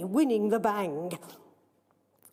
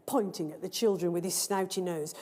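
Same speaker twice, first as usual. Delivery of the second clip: plain reading voice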